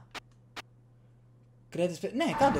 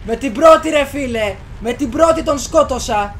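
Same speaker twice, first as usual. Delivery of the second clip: loud, higher, stressing πρώτη; excited boast; 5 semitones higher, 9 dB louder than usual. Real, not acted. Gamer bragging to viewers about a first-try kill, excited boast. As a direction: savour the first-try win.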